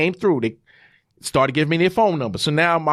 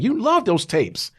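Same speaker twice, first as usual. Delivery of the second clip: son's voice; 10 semitones higher than usual. Retold or imitated. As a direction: voice the son getting more excited. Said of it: "My son is like".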